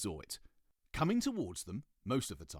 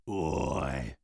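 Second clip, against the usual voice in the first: low, louder gruff call; gruff badger; 5 semitones lower, 7 dB louder than usual. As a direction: bark it like a rough badger. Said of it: "said Harry the Badger gruffly".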